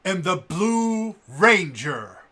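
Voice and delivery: very slow, loud, high proclamation; heroic declaration. Retold or imitated. Bulk stepping up to the villain, slow heroic announcement. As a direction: stand tall and announce it to the whole crowd. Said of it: "out walks Bulk and he goes".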